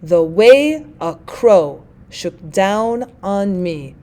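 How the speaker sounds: very slow, measured beat, drawing out "way", "crow", "down" and "me"; iambic stress. Shown as a demonstration. Teacher repeating the example to show the beat, deliberate iambic stress. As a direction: scan it slowly, light on "The", "a", "shook", "on", weight on the words between. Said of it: "Unstressed syllable followed by a stressed syllable".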